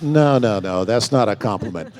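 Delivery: a hammed-up loud correction of the actor; Gene's emphatic correction. Gene correcting the actor, loud and insistent. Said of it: "He goes".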